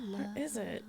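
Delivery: whispered searching aside; very soft, voiceless murmur; talking to oneself. Real, not acted. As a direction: whisper it to yourself while hunting for something.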